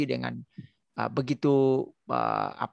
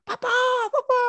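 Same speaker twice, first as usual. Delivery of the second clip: sudden high, loud cry for papa; startled scream; 4 dB louder than usual. Retold or imitated. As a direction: shriek for help in a fright. Wife screams in fright at cockroach. (teriakan kaget, tinggi dan keras)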